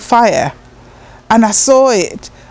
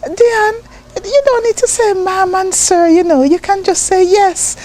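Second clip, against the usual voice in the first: gentle mum; 9 semitones higher than usual. Retold or imitated. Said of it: "I remember mom saying".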